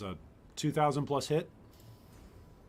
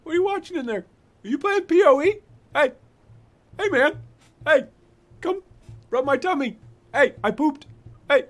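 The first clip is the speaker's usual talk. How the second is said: very high, loud dog yapping out words; short bursts like a dog jumping up and down; excited dog; 12 semitones higher, 12 dB louder than usual. Pretend. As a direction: pester the owner as an over-eager dog. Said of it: "He's, like, jumping up and down".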